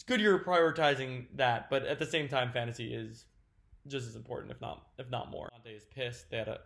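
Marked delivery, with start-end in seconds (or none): none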